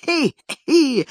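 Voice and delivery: put-on high, spiteful old man's titter; mocking high-pitched snicker; high spiteful giggle. A narrator's reading. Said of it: "закричал на Никитку".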